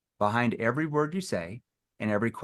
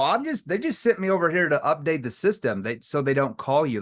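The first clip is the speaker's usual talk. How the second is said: high, loud playful whoa, whoa; playful, teasing caller; 5 semitones higher, 3 dB louder than usual. Retold or imitated. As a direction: tease them with a bright high whoa. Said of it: "why were you using a playful tone".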